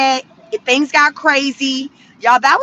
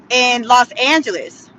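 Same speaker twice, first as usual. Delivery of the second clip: normal talking voice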